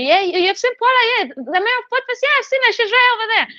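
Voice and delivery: mock bragging introduction; imitating Adam. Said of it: "was telling everybody".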